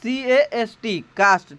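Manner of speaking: spelled and said slowly as a model; slow it down so students follow; slow spelling. Shown as a demonstration. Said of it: "अगला meaning हमारा दोस्तों है सीएएसटी cast". (धीमा, साफ़ उच्चारण)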